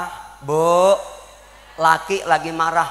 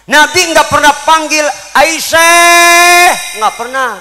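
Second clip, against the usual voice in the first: raised, loud insistent repeat; emphatic preaching; 7 semitones higher, 8 dB louder than usual. Acted. Preacher to congregation, loud emphatic repeat. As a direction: hammer it home louder and higher.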